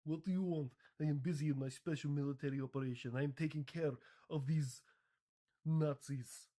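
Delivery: mock Russian accent. Russia says it is busy, in a mock Russian accent. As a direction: brush them off in a put-on Russian accent. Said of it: "Anyways, Russia".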